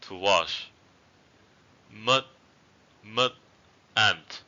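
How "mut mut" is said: "Mut" with a short vowel and unreleased final stop. Shown as a demonstration. Teacher giving an eighth-tone example, short "Mut". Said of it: "example with short vowels".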